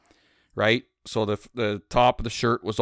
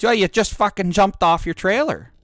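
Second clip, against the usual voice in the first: higher, louder exasperated mock of the old trucker; imitating an exasperated old-timer; exasperated retort; 9 semitones higher, 4 dB louder than usual. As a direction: throw it back at him, fed up.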